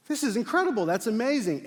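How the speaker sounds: high, bright burst of delight; thrilled squeal of joy; excited. Retold or imitated. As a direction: gush like you can't believe it. Pastor's reaction to baptism request.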